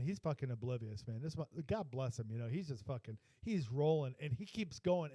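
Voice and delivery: ordinary talk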